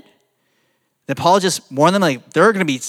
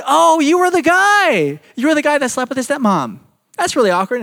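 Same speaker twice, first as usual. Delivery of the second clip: mock awkward heaven greeting; 8 semitones higher than usual.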